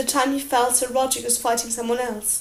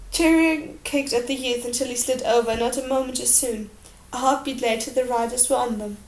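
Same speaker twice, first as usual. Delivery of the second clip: ordinary delivery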